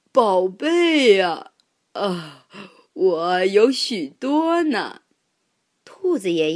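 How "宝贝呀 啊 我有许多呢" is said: put-on slow, loud old grandpa voice; old rabbit grandpa. A narrator's reading. Grandpa rabbit greets the young travellers, slow elder. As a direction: savour each word like a kindly elder.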